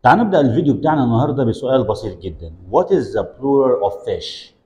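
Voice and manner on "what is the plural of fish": slow model question. Shown as a demonstration. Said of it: "teacher models slow question".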